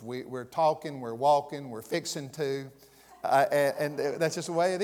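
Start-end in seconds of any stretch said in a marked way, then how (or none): 0.2-2.7 Southern endings, "-in'" for "-ing" on "talking, walking, fixing"; dropped final G's. Shown as a demonstration. Pastor shows congregation Southern speech, G's dropped from "talking, walking, fixing". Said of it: "we don't put G's on the end".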